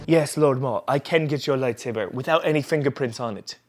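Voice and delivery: put-on bow to Lord Maul in a mystery accent; odd unplaced accent. Reviewer playing a servant to Lord Maul, odd-accent reply. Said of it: "I don't know what accent he was trying to do there".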